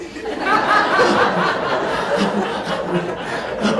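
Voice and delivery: loud high laugh; real high laughter; big high laugh. Real, not acted. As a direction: burst out laughing. Big laugh after birth story.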